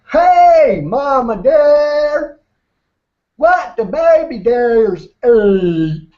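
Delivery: high, loud, drawn-out holler; big-mouth frog. Acted. Baby frog calling out to a mama deer, big-mouth voice. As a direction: stretch every word wide, loud and high.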